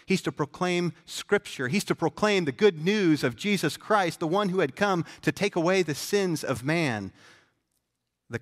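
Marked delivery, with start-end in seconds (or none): 0.1-7.1 higher, louder preaching; fervent preaching. Acted. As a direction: proclaim it with full conviction. Preaching with rising force.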